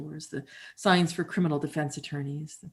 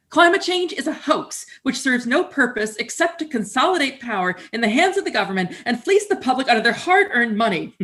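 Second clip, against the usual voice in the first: higher, louder mock rant, all conviction; ranting commenter; 7 semitones higher, 6 dB louder than usual. Voicing a denier's comment to the audience, high loud mock rant. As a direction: hammer it like an outraged troll.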